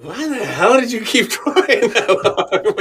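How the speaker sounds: high, slow, laughing incredulous quote; mock the doubters through a laugh; laughing mock question. Imagined critics asking him, laughing disbelief.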